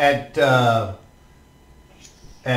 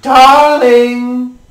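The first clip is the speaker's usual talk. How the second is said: loud, high cry of darling; calling out; 9 semitones higher, 10 dB louder than usual. Acted. Mother calling her husband, loud and high. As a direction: call out to him across the hall. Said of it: "she goes".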